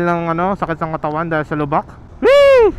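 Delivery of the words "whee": high squeal with a giggle; gleeful whee. Real, not acted. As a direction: squeal with delight. Gleeful over potholes.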